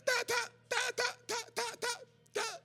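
high-pitched, halting syllables; hammed-up, squeaky ta, ta, ta; a beginner's first words of tongues, mimicked high; imitating his halting tongues. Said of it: "And I was like".

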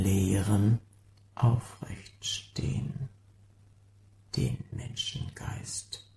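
unvoiced, sinking to almost nothing; dwindling whisper; fading whisper. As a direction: whisper it away into silence. Sound poem, fading line end.

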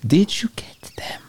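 conspiratorial whisper; hushed whisper. Acted. Henchman secretly checks with Carl on the radio, whispering.

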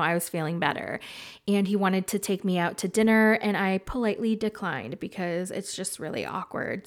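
normal talking voice

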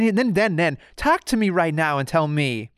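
ordinary talk, nothing marked

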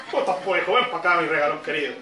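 put-on low-pitched Chilean dad-boss greeting his child; gerente chileno cariñoso. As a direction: drop low for the manager greeting his son. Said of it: "Y el gerente le dice". (gerente chileno saludando a su hijo, voz grave)

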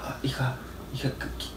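hushed mother. Retold or imitated. Mother whispering to daughter.

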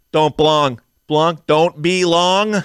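put-on a lightly accented "blong," ending slowly on "Don't be long"; drawing out the mashed-together words, mildly accented; movie accent quote. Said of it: "he kept combining words together".

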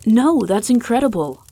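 loud, high, thrilled outburst; excited cry. A narrator's reading. Young man to the elf king, loud and excited. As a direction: blurt it out in sheer delight. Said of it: "Seb cried in excitement".

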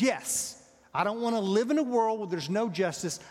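loud, heated protest; angry Jonah. Acted. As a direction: Jonah insisting hotly that justice must stand. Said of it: "And I'm really angry about it".

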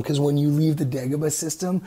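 ordinary talk

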